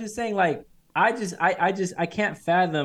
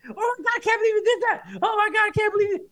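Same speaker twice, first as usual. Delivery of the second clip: high-pitched mock freak-out; losing his mind. Dude reacts to his buddy approaching the girl, losing his mind. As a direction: imitate a friend in squealing disbelief. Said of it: "he just lost his mind".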